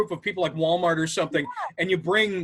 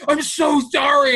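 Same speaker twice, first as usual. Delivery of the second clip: louder, slowed-down mock apology; 5 semitones higher, 5 dB louder than usual. Embarrassed lady apologizing to stranger, louder and slow. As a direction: stretch the sorry out loud. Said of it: "And she's like".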